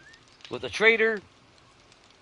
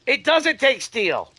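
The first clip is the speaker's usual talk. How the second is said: sharp gasp, then slow and higher, amazed; gasp of surprise; 9 semitones higher, 4 dB louder, much slower than usual. Real, not acted. Gamer realizing the build needs no steel, surprised gasp. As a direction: catch your breath at the good news.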